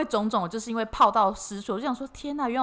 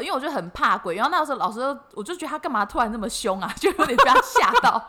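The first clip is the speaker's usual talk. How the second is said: usual speaking voice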